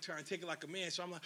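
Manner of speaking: ordinary delivery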